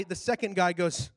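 ordinary delivery

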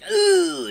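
high, strained groan of pain; acted pained groan. Husband poked hard in the stomach by his wife, acted pained groan.

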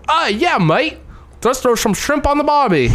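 high, hammed-up Aussie bloke accent; fake Australian accent.

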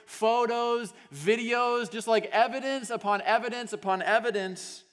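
loud, high, driving sermon voice; fired-up preacher telling the story; full-force preaching. Acted. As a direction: preach it out to the whole room.

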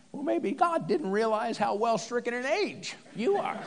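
high-pitched put-on wife voice; retorting wife. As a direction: fire back at the husband in a high voice. Sarah's retort to Abraham, acted.

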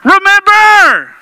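loud, high, drawn-out call; slow loud preacher's cry; booming shout. Acted. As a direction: belt it out to the room. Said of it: "If that's how it helps you remember".

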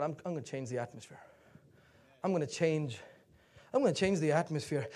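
low, soft, self-directed vow; hushed and determined; quiet resolve. Acted. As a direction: murmur it as a private decision. Quoting a settled inner resolve.

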